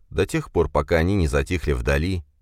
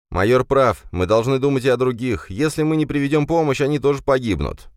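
raised earnest male voice; Ben, firm and urgent; 8 semitones higher, 3 dB louder than usual. A narrator's reading. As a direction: press the point firmly.

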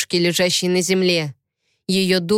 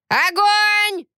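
shouted command; 14 semitones higher than usual. Acted. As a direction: yell the order. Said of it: "крикнул старик". (громкий крик)

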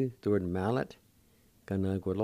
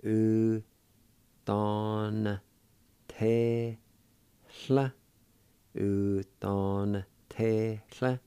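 syllable by syllable; much slower than usual. Shown as a demonstration. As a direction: pause between every syllable. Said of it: "pronounce the N sound with the D A like this".

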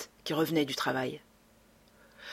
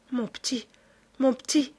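Victoire stammers, a touch higher; stammering; 7 semitones higher than usual. Acted. Old Victoire stammers to Lupin in grief, faltering. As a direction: falter it through shock. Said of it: "Victoire balbutiait".

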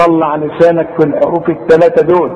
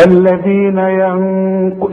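slow recitation; 3 semitones higher, much slower than usual. Shown as a demonstration. Teacher recites with ghunna on the nun. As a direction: chant الذين ينق slowly, drawing it out. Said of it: "لازم تغنها".

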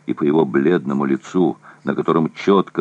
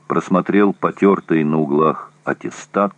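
ordinary delivery

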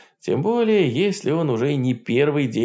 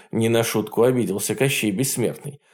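ordinary delivery

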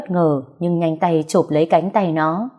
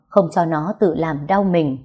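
plain reading voice

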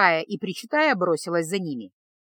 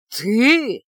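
louder, scornful exhale; contemptuous; 2 semitones higher, 6 dB louder than usual. A narrator's reading. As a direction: spit it out in disdain. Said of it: "презрительно выдохнул". (презрительно, громче)

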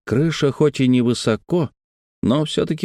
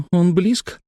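high, anxious child's question, voiced; frightened whisper; 9 semitones higher than usual. A narrator's reading. Girl asks Grant if tyrannosaur is near, high fearful voice. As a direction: ask fearfully as a small girl, pitched up. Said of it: "испуганно прошептала Лекси".

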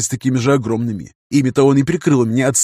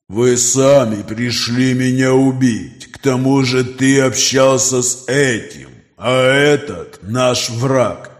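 slow, distant predatory creature; monster voice; much slower than usual. Acted. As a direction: speak like a cold ancient beast. Creature Nokris speaks.

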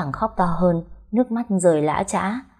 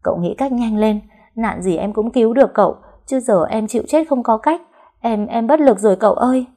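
higher-pitched, helpless wail; panicked cries; 5 semitones higher, 4 dB louder than usual. A narrator's reading. Wife begging husband to save them, panicked cries. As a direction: plead urgently for a way out. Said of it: "không ngừng la hét".

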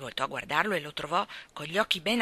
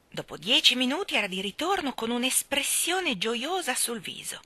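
ordinary delivery

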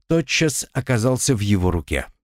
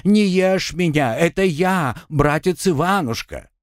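high, mocking imitation of a whiner; передразнивание; 8 semitones higher than usual. A narrator's reading. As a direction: mimic his pleading in a raised bleat. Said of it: "передразнил его Артём". (насмешливо передразнивая жалобным голоском)

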